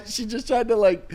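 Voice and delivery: ordinary talk, nothing marked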